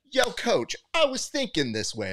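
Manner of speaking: put-on high, eager young kid; light kid voice; kid's voice. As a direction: play the naive teenager calling coach. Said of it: "he was like".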